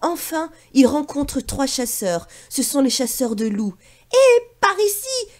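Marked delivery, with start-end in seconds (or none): 4.1-5.3 high, loud chirped call; chirping bird call. Acted. Sacha calls the hunters, high and loud. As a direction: cheep it like a bird. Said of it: "piaille Sacha".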